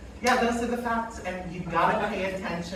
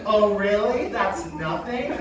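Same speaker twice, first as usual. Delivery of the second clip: normal talking voice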